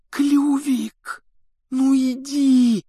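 high, slow, strained urging; desperate hissing. A narrator's reading. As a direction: hiss a desperate plea to a stubborn animal. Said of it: "отчаянно шипел Гарри".

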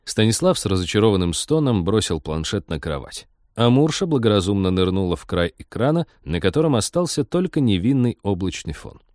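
ordinary delivery, nothing marked

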